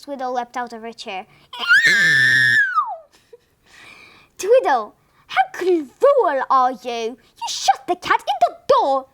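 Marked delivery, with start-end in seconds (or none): 1.5-3.0 high loud pained cat yowl. A narrator's reading. Cat yowls, tail caught. 4.4-9.1 high, loud indignant wife; shocked scolding. A narrator's reading.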